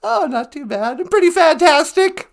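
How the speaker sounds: put-on high, loud old lady voice; grandma character voice. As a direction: chirp it like a sweet old granny. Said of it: "Nana Bonjour".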